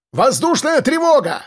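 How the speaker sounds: high-pitched commander's alarm cry; urgent high shout without much added volume; yelled alarm. Acted. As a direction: yell a warning to the whole crew. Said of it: "заорал командир".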